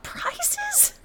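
high, soft disbelieving squeak; incredulous squeak. Retold or imitated. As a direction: squeak the word Prizes in horror. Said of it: "What was I thinking of?".

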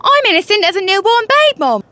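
feigned innocence in Cockney; Cockney chambermaid. Acted. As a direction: plead innocence in a Cockney accent. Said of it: "guise of a Cockney chambermaid".

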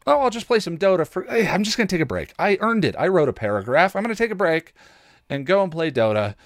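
high, quick, self-justifying chatter; hammed-up eager voice of his lazy self; mock eager excuses. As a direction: rattle off excuses like a kid sneaking a break. Voicing his own procrastinating excuses.

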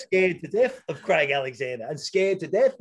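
chuckling as he admits being scared; laughing. Real, not acted. Athlete laughing to the host about his fear.

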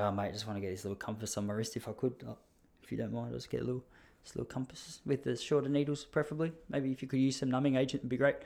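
quiet and fast, shrinking away; meek nervous request. Retold or imitated. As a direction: ask softly and hurriedly, like a timid customer. Nervous customer asking tattoo artist.

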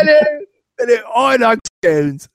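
high-pitched, badly faked British; terrible British accent. Shown as a demonstration. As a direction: ham up an awful British accent. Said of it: "My terrible British accent".

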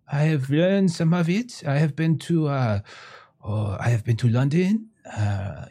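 put-on high, eager French accent; French merchant. Eccentric French artist welcoming a visitor into his tent, high eager French accent. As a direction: fawn over the customer in a thick French accent.

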